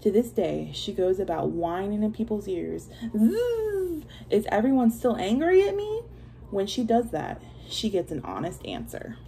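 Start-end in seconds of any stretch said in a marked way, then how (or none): 3.2-4.0 mosquito buzz. Acted. Mosquito buzzing. 4.3-6.0 whiny mosquito voice. Acted. Mosquito whining voice.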